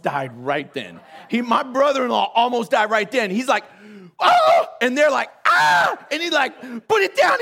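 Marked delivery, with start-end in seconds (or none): 4.2-4.7 very high, loud scream; mock startled scream. Brother-in-law surprised by armed officers, imitated scream. 5.5-6.0 loud, high answering scream; mock frightened yell. Officers startled by the red light, imitated scream. 6.9-7.4 very high, loud command; mock panicked order. Officers confronting brother-in-law, imitated panicked shouting.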